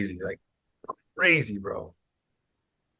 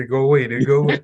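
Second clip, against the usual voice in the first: louder, higher imitated plea; mock shooing; 4 semitones higher, 7 dB louder than usual. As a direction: imitate someone shooing a man off, amused. Retold heckler shooing, louder.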